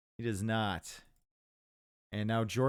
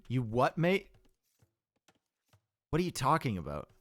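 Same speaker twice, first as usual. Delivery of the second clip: mock Aussie. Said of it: "We sound nothing like Australians".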